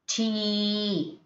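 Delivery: "tea" with a long, held ee; prolonged E. Shown as a demonstration. Teacher giving an example word, prolonged E. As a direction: stretch the end of "tea". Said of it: "you have to prolong it".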